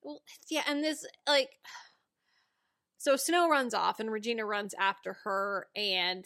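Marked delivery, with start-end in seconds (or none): none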